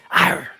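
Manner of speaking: imitated big-cat "arr"; tiger growl. Man imitating women's reaction to underwear, tiger growl. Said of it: "They go ... like on some tiger".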